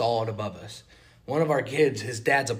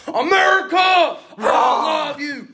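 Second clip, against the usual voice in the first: high, loud, drawn-out patriotic holler; mock patriotic shout; 10 dB louder, much slower than usual. Camper cheers every bald eagle he saw, hammed-up high shout. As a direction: belt it out in over-the-top national pride.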